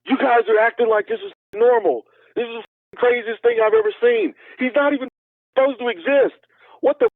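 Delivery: high, panicked mock yell; theatrical freak-out. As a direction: ham up a terrified friend. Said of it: "much more theatrical than that".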